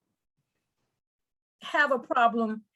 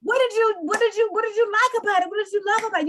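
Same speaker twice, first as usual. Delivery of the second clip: much higher, louder, fast and thrilled; excited friend; 9 semitones higher, 3 dB louder, faster than usual. Pretend. Friend hearing about the Italy trip, gushing excitedly. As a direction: gush with delight at the news. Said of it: "You say".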